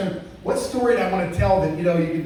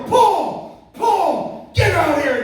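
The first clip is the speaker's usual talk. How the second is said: angry yell; 11 semitones higher, much slower than usual. Retold or imitated. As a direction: chase him off the track with a shout. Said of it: "she is pissed at Paul".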